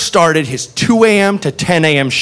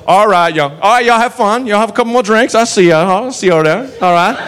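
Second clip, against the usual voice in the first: easygoing small-town lawman farewell; folksy Southern cop; 4 semitones higher than usual. Retold or imitated. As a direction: wave the regulars off genially. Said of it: "And he goes".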